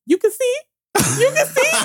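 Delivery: high, loud mock squeal; mocking mom imitation. Mom on FaceTime during proposal, mocking imitation. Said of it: "on FaceTime like a dummy".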